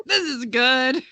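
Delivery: half-laughing smug verdict; mock-proud, through a laugh; laughing mock pride. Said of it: "and be like".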